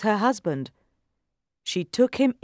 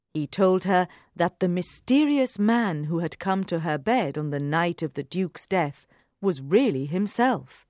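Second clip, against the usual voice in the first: ordinary delivery, nothing marked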